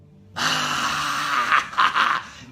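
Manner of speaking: loud, long demonstrative breath; big exaggerated cleansing sigh. Shown as a demonstration.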